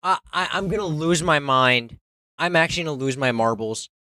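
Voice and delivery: loud exasperated outburst; frustrated yelling. Real, not acted. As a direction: yell it out in frustration.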